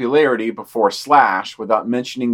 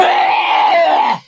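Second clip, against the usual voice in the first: high, loud burst of laughter; 5 dB louder than usual. Real, not acted. Cohost losing it on air, loud laughing burst. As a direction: let out a big, breathy laugh. Cohost cracking up mid-read.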